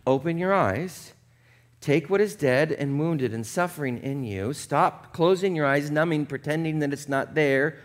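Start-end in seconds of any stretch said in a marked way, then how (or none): none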